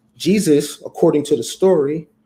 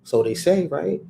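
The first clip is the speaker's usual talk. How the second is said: ordinary talk, nothing marked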